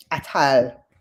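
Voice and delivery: usual speaking voice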